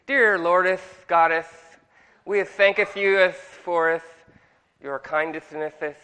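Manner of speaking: slow, pompous King James praying; overdone churchy prayer voice; mock holy prayer. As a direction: ham up a fake holy prayer with -eth on every word. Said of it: "we think that makes us sound holier".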